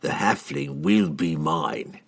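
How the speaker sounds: a calm, certain vow; evenly. A narrator's reading. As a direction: assassin calmly promising the kill. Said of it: "he said evenly".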